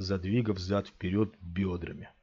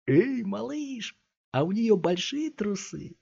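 much higher, slow leering sing-song; lewd taunt; 12 semitones higher, slower than usual. A narrator's reading. Thug leers at the boy about his mother. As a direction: draw it out to provoke.

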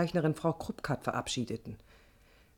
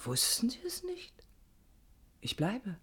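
whisper; 3 semitones higher, 8 dB quieter than usual. Acted. Intimate reveal to her boss.

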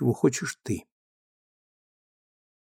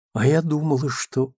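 ordinary delivery

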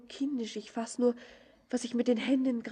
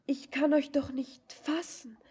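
ordinary talk, nothing marked

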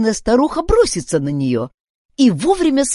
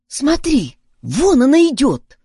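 urgent, higher and louder warning; startled alert; 5 semitones higher, 5 dB louder than usual. A narrator's reading. Boy warns girl that the old woman is coming, startled. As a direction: snap to attention and point her out. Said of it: "встрепенулся Фатти".

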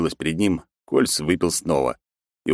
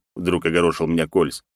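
normal talking voice

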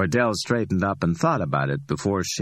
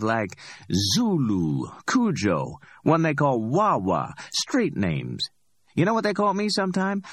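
higher, amused street hustler voice; cocky Ordell; 8 semitones higher than usual. Acted. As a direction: play Ordell, high and pleased with himself. Ordell's long speech, held voice.

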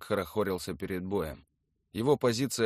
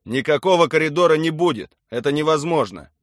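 loud, raised, commanding; loud officer who won't negotiate; firm refusal; 7 semitones higher, 12 dB louder than usual. A narrator's reading. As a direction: shut the demand down forcefully.

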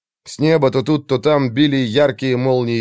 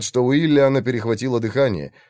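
ordinary delivery, nothing marked

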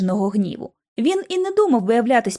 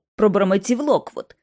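ordinary delivery

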